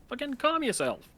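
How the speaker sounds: high, exasperated mock retort; mock annoyed snap; irritated dismissal. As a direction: brush off the nagging princess. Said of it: "And he's like".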